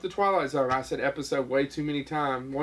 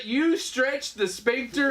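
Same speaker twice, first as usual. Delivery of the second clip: incredulous; 7 semitones higher, slower than usual. Real, not acted. Incredulous slow question.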